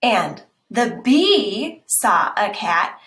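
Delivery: slow, loud stress on "fox"; slow storybook emphasis; slow emphatic reading. Shown as a demonstration. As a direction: draw out "fox" and land "saw a cat". Reading italic lines slowly.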